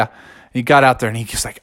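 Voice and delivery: ordinary delivery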